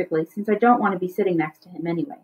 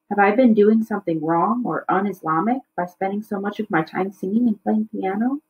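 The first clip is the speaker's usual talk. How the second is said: ordinary delivery, nothing marked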